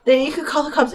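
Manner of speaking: high, mock-pitiful drunk friend; drunk pleading. Drunk friend begs mom not to call her parents, high mock pleading. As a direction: plead like a drunk who's in trouble.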